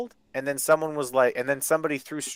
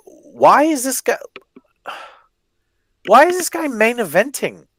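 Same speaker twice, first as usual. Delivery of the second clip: high, loud, indignant question; loud incredulous complaint; exasperated disbelief; 9 semitones higher, 7 dB louder than usual. Real, not acted. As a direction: demand an answer, pitched right up. Exasperated rant about the match.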